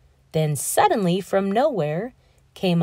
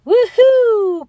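a much higher, much louder cheer; joyful whoop; 13 semitones higher, 10 dB louder than usual. Acted. Pip-Pip soars into the sky, joyful whoop. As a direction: whoop with the joy of flying. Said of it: "cried little Pip-Pip".

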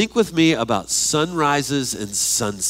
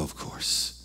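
soft, breathless whisper of realization; awed hush; 12 dB quieter than usual. Pretend. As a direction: breathe it out in wonder. Acted awe on entering heaven.

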